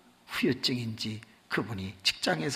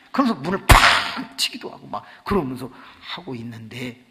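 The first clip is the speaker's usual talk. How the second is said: ordinary talk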